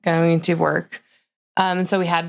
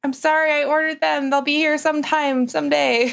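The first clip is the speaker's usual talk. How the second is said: higher-pitched, lightly pleading excuse; mock exasperated apology; 8 semitones higher than usual. Owner answering customers who keep asking, mock exasperated apology. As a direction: play at fending off pestering.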